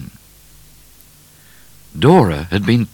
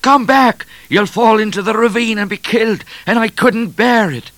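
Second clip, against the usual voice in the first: high, loud desperate pleading; alarmed, anguished shout; crying out; 7 dB louder than usual. A narrator's reading. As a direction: plead at full pitch with someone in danger. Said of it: "cried Trooper O'Grady".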